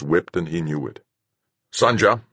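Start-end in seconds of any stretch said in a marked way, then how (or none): 1.7-2.2 louder, higher summons; commanding. A narrator's reading. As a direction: call the crewman to attention. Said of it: "the captain commanded".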